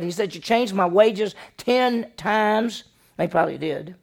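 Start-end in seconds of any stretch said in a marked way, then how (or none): 0.3-2.8 higher, louder, slow accusation; angry accusation. Retold or imitated. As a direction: spit out each word slowly. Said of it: "He is really, really mad".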